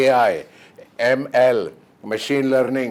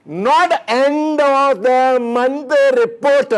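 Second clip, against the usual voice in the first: mock wail; 11 semitones higher, 6 dB louder, slower than usual. Mock horror at late report.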